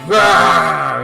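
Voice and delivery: loud flustered rah; loud panicky outburst; panicked roar. Retold or imitated. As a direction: blurt a mock shout. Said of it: "And I was like".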